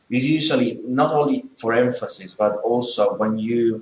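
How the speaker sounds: normal talking voice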